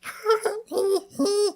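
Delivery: very high strained squeak; squeaky voice attempt. Shown as a demonstration. Trying a voice with a sore throat, high squeak. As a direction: push out a tiny, high squeal. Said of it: "pull off this voice".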